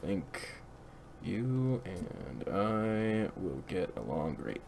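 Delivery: low, slow, creepy hush; creepy low murmur. Acted. Joking to Zach, a creepy low murmur. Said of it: "that sounds creepy as fuck".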